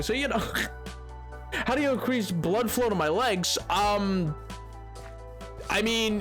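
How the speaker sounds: ordinary talk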